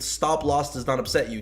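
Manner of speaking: normal talking voice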